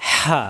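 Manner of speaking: হা squeezed from mid-throat; throaty ha. Shown as a demonstration. Teacher shows the letter, হা pressed from mid-throat. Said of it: "কণ্ঠনালীর মাঝখানে চাপ দিয়ে".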